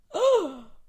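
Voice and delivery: high, voiced gasp; shocked gasp. Real, not acted. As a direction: gasp at a harsh line. Reacting to the sad quote.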